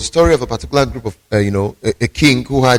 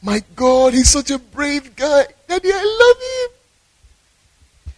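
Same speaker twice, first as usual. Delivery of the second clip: very high, drawn-out lovestruck squeal; hammed-up girlish adoration, slow and squeaky; swooning girl; 12 semitones higher, slower than usual. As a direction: gush like a smitten girl, pitched way up. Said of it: "The girl in question was like".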